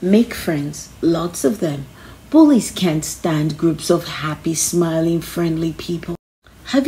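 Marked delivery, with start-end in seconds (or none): none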